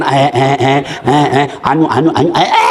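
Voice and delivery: low, stuck stammering fillers; mock tongue-tied politician, pitched low; bungling speech-maker. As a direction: stammer as if lost in a prepared speech. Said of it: "masih salah sambutan".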